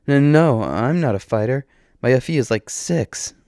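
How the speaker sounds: flustered, rising denial; upset. A narrator's reading. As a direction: stammer out an upset no. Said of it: "sounding upset at the idea that she would fight".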